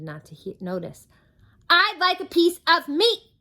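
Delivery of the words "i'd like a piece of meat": high, loud shout. Acted. Mister Noisy shouts his order at the butcher, shouting. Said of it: "Mister Noisy shouted".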